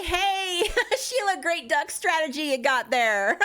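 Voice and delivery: high, hammed-up praise breaking into laughter; mock congratulations. Imagined admirer praising the duct strategy, laughing mock congratulations. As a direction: cheer them on in a laughing, high-pitched put-on voice.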